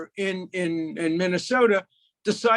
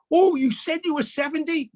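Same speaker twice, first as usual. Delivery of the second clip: higher, questioning exclamation; surprised guess; 7 semitones higher, 3 dB louder than usual. Acted. Old friend guessing the age he lied about, higher and surprised. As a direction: pitch it up in mock surprise.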